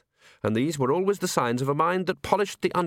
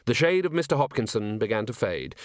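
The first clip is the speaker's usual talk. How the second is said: usual speaking voice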